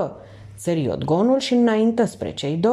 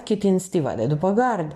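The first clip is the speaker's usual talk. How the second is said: ordinary delivery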